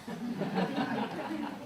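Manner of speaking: breathy little laugh at himself; airy chuckle at the bookshelf fright; soft laugh. Real, not acted. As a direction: let a quiet laugh slip out.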